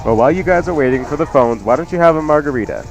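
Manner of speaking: usual speaking voice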